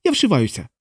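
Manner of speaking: high-pitched, startled outburst; alarmed henchman. A narrator's reading. A henchman decides to flee, high and alarmed. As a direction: blurt it high and bail out.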